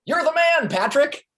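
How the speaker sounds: high, loud hype-man cheer; hyped-up praise. Acted. Boss cheering his intern on, high and loud. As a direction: pump him up, big and proud.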